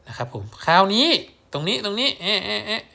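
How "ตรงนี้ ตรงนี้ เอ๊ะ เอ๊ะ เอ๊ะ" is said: puzzled. Real, not acted. Teacher confused at the board.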